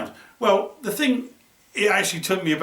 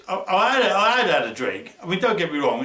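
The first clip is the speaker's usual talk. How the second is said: ordinary delivery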